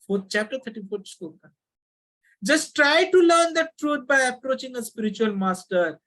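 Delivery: ordinary talk, nothing marked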